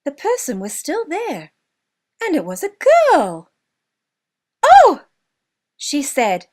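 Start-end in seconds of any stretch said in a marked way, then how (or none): 4.6-5.1 high, loud surprised gasp of a word; startled oh. Acted. Girl startled by Jimmy at the tree stump, high loud oh. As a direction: jump with it, caught off guard. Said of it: "jumped up in surprise".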